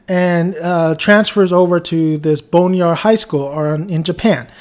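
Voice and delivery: ordinary talk, nothing marked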